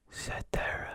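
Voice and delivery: soft unvoiced whisper into one ear. Shown as a demonstration. Doctor whispering a test word to patient, whispered. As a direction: breathe the word close to the ear. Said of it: "we're gonna whisper in the other".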